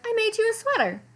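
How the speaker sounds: put-on high, sweet little-girl voice; sweet little cousin. A narrator's reading. Clara offering her homemade gift, high and sweet. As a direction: chirp it up brightly as Clara.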